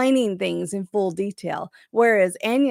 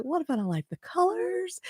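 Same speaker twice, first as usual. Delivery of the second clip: worried; 8 semitones higher, 8 dB quieter than usual. Retold or imitated. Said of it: "I was like".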